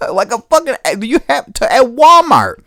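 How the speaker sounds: high, loud disbelief breaking into laughter; can't believe it, laughing through it; incredulous excitement. Real, not acted. Marvelling to viewers about gun laws, high and laughing.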